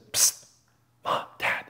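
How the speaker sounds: soft hushed whisper to parents. Retold or imitated. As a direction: hiss it quietly so nobody hears. Sisters whisper during church service.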